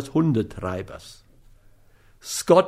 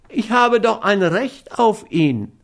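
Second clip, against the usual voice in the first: high, plaintive protest; whiny; 8 semitones higher than usual. A narrator's reading. Dog owner protests to the man about to hit him, whiny. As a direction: call it out tearfully, pitched high. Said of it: "rief er weinerlich aus".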